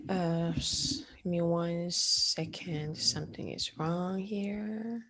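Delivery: murmured half-whisper aside while searching for a file; hushed muttering to self. Real, not acted. Teacher muttering to herself while fixing her slides, hushed. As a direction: drop to a private mumble, not for the class.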